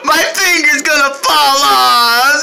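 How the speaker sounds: high, slow sobbing; hammed-up wailing. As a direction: drag the sobs out slowly. Puppet wails about finger.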